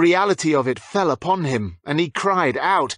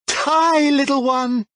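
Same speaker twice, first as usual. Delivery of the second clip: higher, louder taunting cry; gleeful kill cry; 12 semitones higher, 4 dB louder, slower than usual. A narrator's reading. Judith striking Lucy down, a higher, louder gleeful cry. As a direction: fling the words like a careless curse. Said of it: "Judith laughed and flicked a finger".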